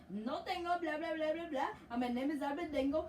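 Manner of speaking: lightly hammed African accent for the goalkeeper; mock African accent. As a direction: voice the player in a jokey African accent. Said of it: "I gave him a freaking African accent".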